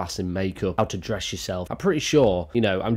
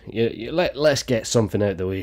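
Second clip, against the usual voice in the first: ordinary talk, nothing marked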